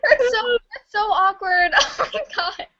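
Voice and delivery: high, slowed words through laughter; cracking up at an awkward story; laughing hard. Real, not acted. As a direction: squeal it out while laughing.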